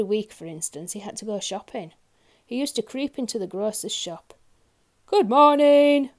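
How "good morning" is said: loud, high, drawn-out greeting; booming shopkeeper's shout; bellowing grocer. A narrator's reading. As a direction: bellow it slowly across the shop. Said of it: "bellowed the grocer".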